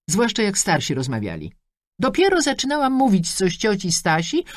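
high, chatty mother rattling off wishes; a pushy mum beaming down the line; mother on the phone. Acted. Mother scolds for interrupting.

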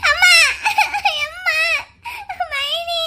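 high-pitched put-on sobbing cries; fake wailing. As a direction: wail for help to draw everyone in. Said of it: "நீ கத்தி கூப்பாடு போடுற கூப்பாடுல எல்லாரும் வரணும்".

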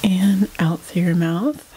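unhurried meditation guide, long pauses between phrases; guided meditation. Real, not acted. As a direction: lead them calmly through breath and body, leaving space after each phrase.

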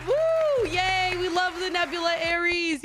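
cheering. Real, not acted. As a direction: cheer like you won. Cheering at a good skin.

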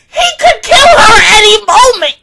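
loud, high, near tears; anxious fan almost crying at the screen; real panicked outcry. Real, not acted. Panicking over Tomoyo with Muzan.

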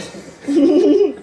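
high, loud laughing fit breaking up the story; real high-pitched burst of laughter. Real, not acted. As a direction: crack up and can't go on.

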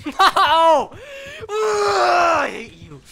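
high, loud mock-crying shout, drawn out slowly; anguished wail. Real, not acted. Gamer wailing at the trapper who caught him again, slow high shout. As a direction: wail it like a frustrated sob.

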